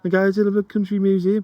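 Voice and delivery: lightly mimicked Brummie accent. Sending visitors to the museum, mimicked Brummie accent. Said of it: "me mimicking their accent now".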